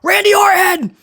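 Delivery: high-pitched mock hype yelling; mock screaming. Host mimics guest host yelling names into a live mic, mock screaming. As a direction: imitate a celebrity screaming names at the crowd. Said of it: "She just started screaming".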